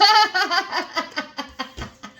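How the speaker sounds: high, long run of laughter; real laugh. Real, not acted. Laughing at her own bad Jamaican accent, real laughter. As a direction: burst out laughing at yourself.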